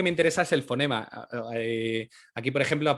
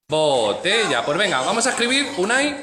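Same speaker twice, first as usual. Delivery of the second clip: teacher voice to class; 3 semitones higher, 6 dB louder, slower than usual. Real, not acted. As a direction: call the class to attention, lively. Teacher on video sounding syllables.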